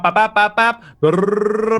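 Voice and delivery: high, loud gesture noises; mock spell gestures. Shown as a demonstration. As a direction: make silly spellcasting noises. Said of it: "you're literally going bap".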